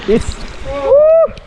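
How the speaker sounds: high yelp at the cold; cold shock whoop. Real, not acted. Plunge into cold water.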